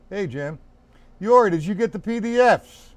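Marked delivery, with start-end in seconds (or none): 1.2-2.9 calling out. Real, not acted. Calling to a student online.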